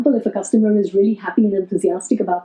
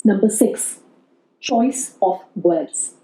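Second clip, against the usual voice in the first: ordinary talk, nothing marked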